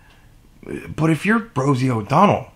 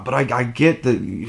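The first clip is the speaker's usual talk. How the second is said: ordinary talk, nothing marked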